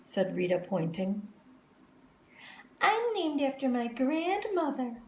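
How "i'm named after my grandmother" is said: put-on proud girl. A narrator's reading. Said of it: "said Victoria".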